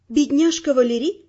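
plain reading voice

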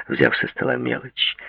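ordinary delivery, nothing marked

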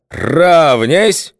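slow, booming drill commands, high and loud; menacing bark. A narrator's reading. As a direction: bark the parade-ground orders. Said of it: "вдруг рявкнул грозно".

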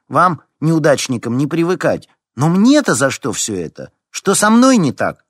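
high, loud outraged complaint; indignant. A narrator's reading. Larisa protesting her place on the list, indignant. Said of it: "возмутилась Лариса".

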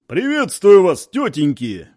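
loud, slow bellow; thunderous yell. A narrator's reading. Deputy political officer greets the Chechen women through a megaphone, thunderous and slow. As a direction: roar it out like a commander. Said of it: "громовым голосом заорал".

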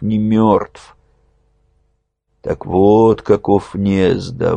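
plain reading voice

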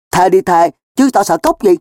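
loud, high-pitched bravado; cocky, combative comeback; blustering. Acted. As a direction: take the dare defiantly. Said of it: "Đang hùng hổ".

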